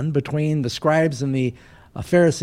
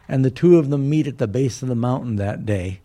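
ordinary talk

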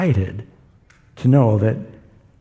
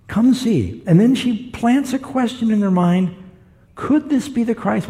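excited call; 10 semitones higher than usual. Retold or imitated. Woman calling townspeople, excited.

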